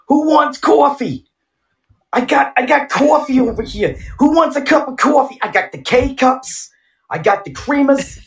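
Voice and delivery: very high, hollering New Yorker impression; high-pitched comic New York street vendor; New Yorker accent. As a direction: shriek it out like a New Yorker hawking coffee. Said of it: "that New Yorker accent".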